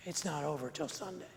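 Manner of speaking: ordinary talk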